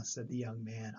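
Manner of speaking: plain reading voice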